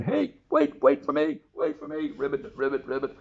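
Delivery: very high, loud pleading frog call; the frog cries out as she runs off; frog calling after her. Acted. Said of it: "the frog came crying".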